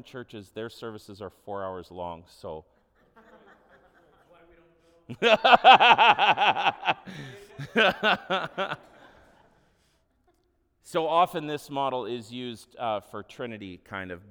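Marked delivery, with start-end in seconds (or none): 5.1-9.2 high, rapid staccato belly laugh; loud laugh. Real, not acted. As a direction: burst out laughing, loud and long. Laughs after remark on long services.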